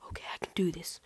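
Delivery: whispered self-pep talk. Acted. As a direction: breathe it to yourself. Psyching self up before castle.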